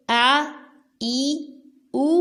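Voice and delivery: آ ای او with a clean glottal start; teacher drilling hamza vowels. Shown as a demonstration. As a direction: open each vowel sharply from the throat. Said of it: "حمزہ کو حلق کے آخری حصے سے".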